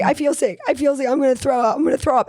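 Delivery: put-on high, pleading child's voice; sick little girl. Daughter telling mom she feels sick, high pleading voice. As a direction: plead like a queasy toddler. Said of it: "then she'd say".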